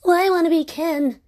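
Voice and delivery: playful high voice. Pretend. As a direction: pipe it up like a kid at play. Voices a playful Barbie-game quip.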